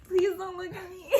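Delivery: pleading while breaking into laughter; laughing plea. Retold or imitated. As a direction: beg again, cracking up. Repeats the plea, now laughing.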